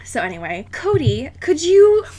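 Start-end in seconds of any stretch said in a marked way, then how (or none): none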